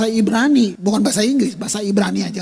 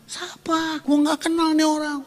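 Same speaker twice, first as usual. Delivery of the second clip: bewildered Saul; 7 semitones higher than usual. Acted. Saul confused by Jesus.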